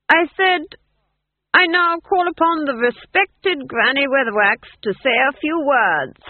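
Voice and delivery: high, loud, over-excited proclamation; unhinged duke calling on a speaker; manic announcement. A narrator's reading. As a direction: shout it high, near giggling. Duke announcing shrilly to crowd.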